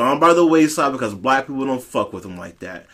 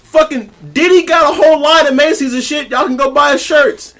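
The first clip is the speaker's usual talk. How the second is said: high-pitched, louder excited rant; 10 semitones higher, 4 dB louder than usual. Real, not acted.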